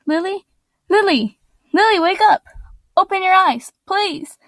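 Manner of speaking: high, pleading calls of her name; crying out. Acted. Serena begs her dead friend Lily to wake up, high crying plea. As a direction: desperately call a fallen friend to wake. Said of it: "cried Serena".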